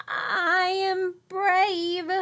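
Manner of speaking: high, slow self-reassurance; frightened girl trying to sound brave; forced bravery. Acted. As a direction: talk yourself into courage. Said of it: "tried to convince herself".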